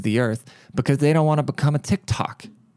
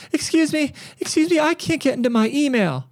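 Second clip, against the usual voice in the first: much higher, squeaky mock Karen voice; frantic old lady. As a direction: imitate a frantic old customer calling for help. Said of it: "And she's all frantic".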